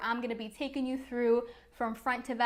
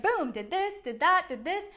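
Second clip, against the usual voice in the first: excited; 5 semitones higher, 3 dB louder than usual. Retold or imitated. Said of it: "I was like".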